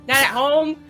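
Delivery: normal talking voice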